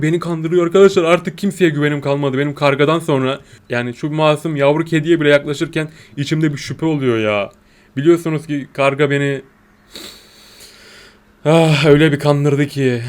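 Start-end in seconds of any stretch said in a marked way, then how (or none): none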